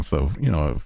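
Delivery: ordinary delivery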